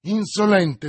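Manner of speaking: loud, sharp, high insult; furious outburst. A narrator's reading. Milady, insulted by the baron, furious loud outburst. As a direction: hurl the word at him. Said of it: "esclamò Milady".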